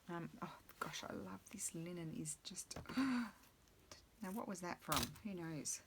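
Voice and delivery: hushed unvoiced whisper over the fabric; whispering to herself. Real, not acted. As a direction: breathe the words while admiring the linen. Whispering over fabric while crafting.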